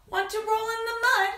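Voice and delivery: high, loud pig's invitation; storybook pig. Acted. Pig invites the spider into the mud, high pig voice. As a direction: pitch it up as a pig asking to play. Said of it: "along came a".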